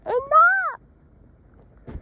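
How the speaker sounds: very high, loud scream. Acted. Sally screams at the fighting group. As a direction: scream to stop everyone. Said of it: "she was starting to scream".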